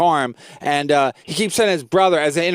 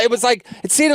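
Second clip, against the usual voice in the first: ordinary talk, nothing marked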